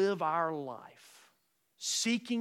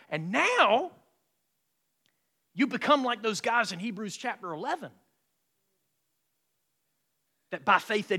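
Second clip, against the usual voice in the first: loud, high, fervent preaching; full-force preaching; 6 semitones higher, 7 dB louder than usual. Acted. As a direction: preach it out to the whole church.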